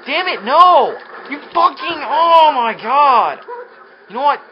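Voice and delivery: real furious yell, high and very loud; furious shout. Real, not acted. Gamer losing the race yet again, furious shouting. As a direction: explode in loud, high-pitched rage. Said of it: "God, I am so pissed".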